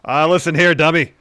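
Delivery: bully the cashier as the cocky champ; L A Knight. Acted. Ordering at Del Taco, loud brash L A Knight impression. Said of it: "L A Knight's gonna take the lead".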